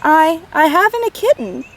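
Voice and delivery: put-on a touch of a tearful stammer from a little girl; half-sobbed, lightly childlike; understated tearful child; mild sobbing. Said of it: "Barbara sobbed".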